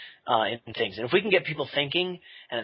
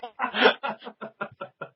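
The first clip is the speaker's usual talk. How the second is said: big laugh; 9 semitones higher than usual. Real, not acted. Laughs at own boast.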